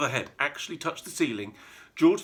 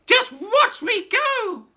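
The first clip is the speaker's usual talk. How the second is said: put-on high, loud, slow screechy old woman; wild old grandma yelling as she rises; shouting old crone; 10 dB louder, much slower than usual. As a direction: stretch it out in a gleeful screech. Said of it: "she called down to George".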